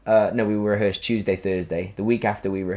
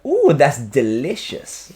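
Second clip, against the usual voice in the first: slow, higher, drawn-out camp purr; camp teasing; 5 semitones higher, 4 dB louder, much slower than usual. Retold or imitated. Teasing the choreographer during his girly section, slow camp purr. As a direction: stretch the ooh like a cheeky tease. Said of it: "I'd be like".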